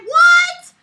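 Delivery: a very high, loud "what"; outraged shriek. Real, not acted. Outraged by the principal in the story, a shrieked what. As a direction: shriek it in pure disbelief.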